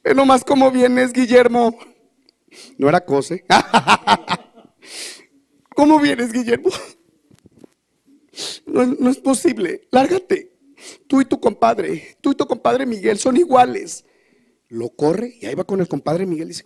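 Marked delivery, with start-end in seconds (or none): 0.0-1.8 regaño agudo de esposa harta; regáñalo más agudo y más fuerte, lento. Acted. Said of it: "la mujer estaba harta". 5.7-14.0 regaño de esposa, agudo y fuerte; échalo de la casa con voz aguda; esposa harta. Acted. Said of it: "la mujer estaba harta".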